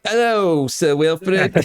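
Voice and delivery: high, put-on British voice for a movie role; a hammed-up English gentleman; mock English accent. Co-host mocks the actor's line in a fake English accent. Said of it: "one of my magnificent English accents".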